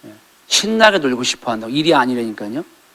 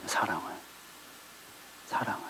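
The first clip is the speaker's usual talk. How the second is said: ordinary delivery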